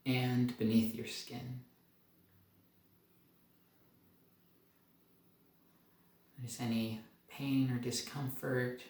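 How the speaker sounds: soft, calm meditation guide; guided meditation. Acted. Doctor leading viewers up through the body, calm and soft. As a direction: lead the body scan gently, letting pauses breathe.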